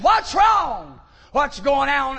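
high startled shouting foreman; foreman's shout. Retold or imitated. Foreman shouting at the uproar in the warehouse, startled shout. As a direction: shout it across the warehouse floor.

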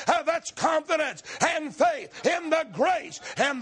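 ringing, shouted preacher's cadence; preaching at full force. Acted. Preacher urging the congregation on the woman's faith, shouting. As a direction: drive the point home to the congregation.

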